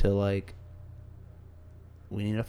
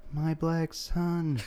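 softer, slow doting dad voice; slow soft fatherly voice; 5 semitones higher, 6 dB quieter, much slower than usual. Retold or imitated. Joking with a coworker, slow soft proud-father voice. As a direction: croon it slowly like a proud father. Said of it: "I was like".

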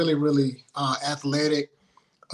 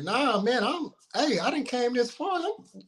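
rising, high-pitched mock protest; indignant young player; 8 semitones higher, 3 dB quieter than usual. Player recalling his indignation at being benched. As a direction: act out the benched kid's disbelief.

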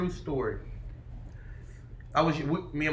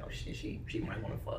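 a private thought, barely breathed; whispered thought; 8 semitones lower, 11 dB quieter than usual. Retold or imitated. To himself, hearing the girl might want him: a whispered thought. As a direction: whisper it under the breath, low and unvoiced. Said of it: "In my head, I'm like".

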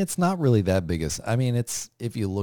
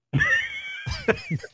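shrieking laugh. Real, not acted. Reacting to the story.

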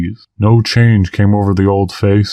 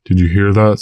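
ordinary delivery

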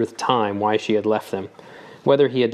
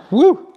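loud high woo after coughing; real relieved exclaim; 10 dB louder than usual. Real, not acted. Reader recovers from a cough, loud and high. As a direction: shake off the coughing fit.

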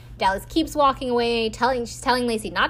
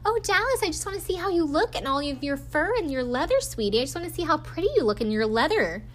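high, syrupy cooing, rushing at the end; creepy sweet voice; 6 semitones higher, faster than usual. Retold or imitated. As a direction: coax her like a creepy admirer. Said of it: "really creepy, sweet voice".

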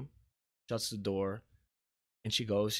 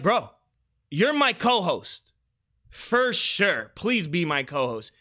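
higher, louder, excited retelling; excited plea; 6 semitones higher, 8 dB louder, slower than usual. Retold or imitated. Host retelling asking his bro to co-host, excited plea. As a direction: retell it as an eager, drawn-out appeal.

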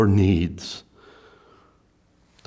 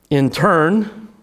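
ordinary talk

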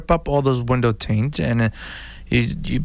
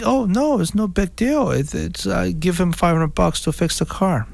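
higher, lightly voiced seller shrugging it off; mock careless seller; 7 semitones higher than usual. Seller shrugging off the repair cost, lightly mock casual. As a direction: play the seller who thinks it's nothing. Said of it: "you think".

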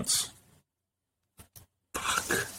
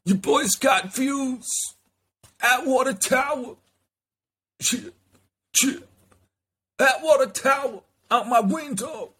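hype persona voice. Acted. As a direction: announce yourself like a strutting alter ego.

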